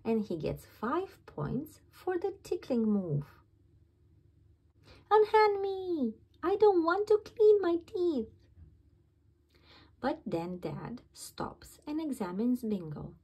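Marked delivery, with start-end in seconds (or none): 5.1-8.3 put-on high, loud child's protest; hammed-up refusal, shouted; shouting. A narrator's reading. Bingo refusing Dad's toothbrush, high loud shout.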